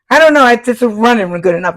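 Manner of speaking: high, fast, loud mock voice; imitating crazy tattooist. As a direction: rattle it off like a wild old tattooist. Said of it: "and he says".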